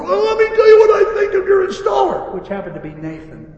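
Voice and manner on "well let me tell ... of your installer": high-pitched shouted tirade; irate customer shout. Retold or imitated. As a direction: yell like a furious customer, played up.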